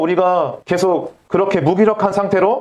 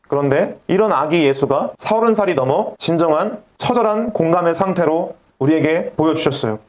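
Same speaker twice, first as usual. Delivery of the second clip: ordinary talk, nothing marked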